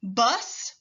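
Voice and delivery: "Bus," ending in a long voiceless s; teacher stretching the final s; final hiss. Shown as a demonstration. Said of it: "Repeat after me".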